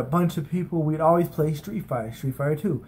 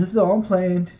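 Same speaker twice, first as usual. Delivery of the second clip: ordinary delivery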